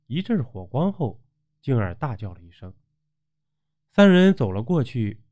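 usual speaking voice

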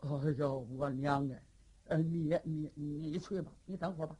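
low, halting dim-witted giant; stammering simpleton. Acted. Li Yuanba frightened, stammering. (结巴憨傻的李元霸，声音低沉)

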